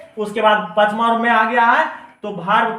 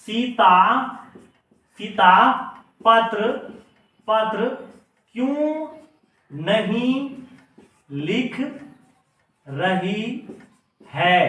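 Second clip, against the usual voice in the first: word-by-word dictation; much slower than usual. Shown as a demonstration. Teacher dictates sentence word by word.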